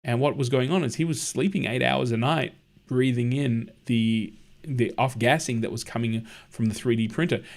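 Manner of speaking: ordinary delivery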